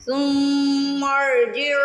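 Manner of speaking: ارجع with a thick, full-mouthed ر after ثم; heavy ra demo. Shown as a demonstration. Teacher demonstrating the heavy ra to students, slow chant. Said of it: "را ساکن سے پہلے میم پہ زبر آ رہی ہے".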